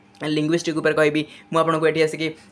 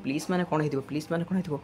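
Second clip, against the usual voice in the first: puzzled student; 9 dB quieter, faster than usual. Pretend. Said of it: "ଆପଣ କହିବେ".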